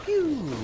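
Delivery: squeaky laser pew; high pew sound effect. Pretend. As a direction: toy blaster zap.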